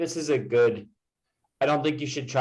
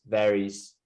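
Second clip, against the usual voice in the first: usual speaking voice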